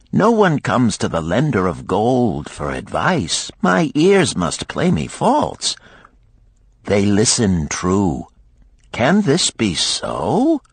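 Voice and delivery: lightly voiced lender with lively, swooping surprise; amazed gold lender. Gold lender to spearmaker who seeks advice, mildly amazed. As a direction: play up mild theatrical astonishment.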